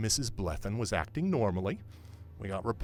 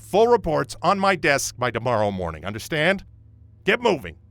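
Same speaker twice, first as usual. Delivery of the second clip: loud, higher barking orders; loud commands; 5 semitones higher, 9 dB louder than usual. Acted. Sergeant demands reports from his officers, loud barking. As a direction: drive them out of the room.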